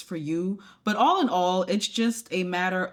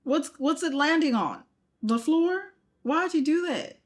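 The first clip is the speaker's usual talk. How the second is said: high, incredulous scolding; exasperated; 10 semitones higher than usual. Real, not acted. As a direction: tease her like a friend who did something silly. Scolding a clumsy demo.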